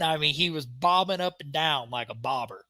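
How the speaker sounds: ordinary delivery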